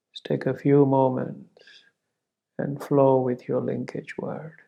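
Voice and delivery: ordinary delivery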